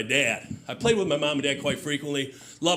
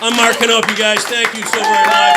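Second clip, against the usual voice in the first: louder sign-off shouted over the crowd; shouting over applause; 7 semitones higher, 14 dB louder than usual. Real, not acted. Comic closing his set over the applause: loud shouted thanks. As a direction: yell thanks over the cheering.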